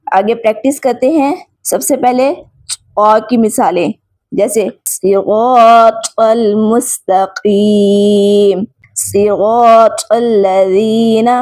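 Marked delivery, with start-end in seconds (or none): 4.9-11.3 slow, drawn-out tajweed recitation of each word; Quran recitation. Shown as a demonstration. Teacher reciting Quran examples for students, slow recitation. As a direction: chant the verse slowly as in tilawat.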